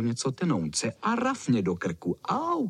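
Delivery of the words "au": high, mock yelp; cry of pain. A narrator's reading. Dog bitten in the neck, high yelp. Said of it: "křičím".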